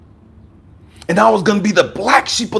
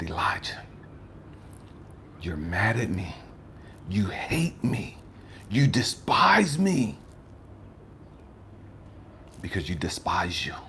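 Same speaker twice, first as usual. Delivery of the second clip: God whispering; 11 semitones lower, 14 dB quieter than usual. Retold or imitated. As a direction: lean in and whisper it gently.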